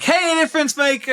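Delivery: high-pitched show-opener greeting. Shown as a demonstration. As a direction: sing out the big upbeat intro. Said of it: "normally it's like".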